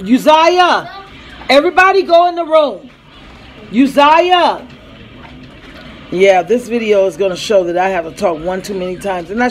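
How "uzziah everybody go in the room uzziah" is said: high, loud call of the name. Real, not acted. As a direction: yell the name across the house. Mom calling child by name.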